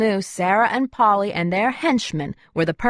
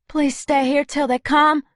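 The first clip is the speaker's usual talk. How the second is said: high, pleading plea to stay; begging; 7 semitones higher than usual. A narrator's reading. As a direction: beg him not to leave. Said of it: "I begged".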